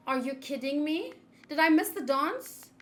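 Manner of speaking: usual speaking voice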